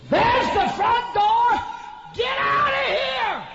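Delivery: very high, slow furious shout; impolite bellow. Retold or imitated. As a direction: bellow into the mic, throwing everyone out. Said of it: "He didn't say this politely".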